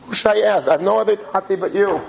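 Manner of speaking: high-pitched imitation of a pleading daughter; retold crying plea. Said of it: "and she's crying".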